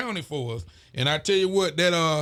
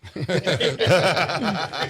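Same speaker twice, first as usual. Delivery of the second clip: laughs. Real, not acted. Laughing at a viewer's comment.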